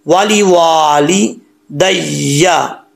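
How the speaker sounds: वाली वाली दैया very slowly, second वा long, doubled य held; doubled ya with shadd. Shown as a demonstration. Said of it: "शद को छोड़ देते हैं".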